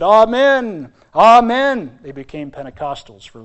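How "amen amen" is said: loud, high Pentecostal-style amen; loud, high mock revival amen; mock fervent amen. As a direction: shout it like a revival crowd. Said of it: "and they said".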